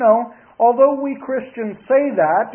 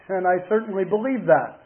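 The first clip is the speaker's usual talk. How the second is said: ordinary delivery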